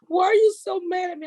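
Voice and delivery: mimicking a friend's hurt complaint; mock whiny Chris. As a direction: imitate him whining about being yelled at. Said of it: "You get emotional".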